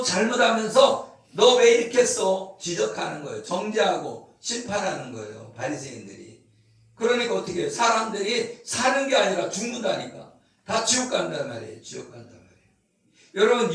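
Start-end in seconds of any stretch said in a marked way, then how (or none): 1.3-2.6 loud, accusing nag; scolding. Acted. Pharisee scolding others. 11.8-12.5 much softer, lower repeat of 지옥 간단 말이에요; soft echo. Real, not acted. Quiet echo of previous line.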